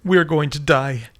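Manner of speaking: high, loud, slow terrified cry; panicked driver crying out in fear; nervous cry. Acted. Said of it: "Nancy nervously cried out".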